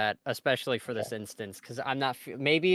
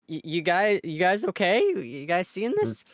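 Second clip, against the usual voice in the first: higher-pitched, stammering bewilderment; very confused; 6 semitones higher, 3 dB louder than usual. Acted. Checking with the others about those two, baffled and higher-pitched. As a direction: stumble into the question, voice lifted in confusion. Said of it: "very confused".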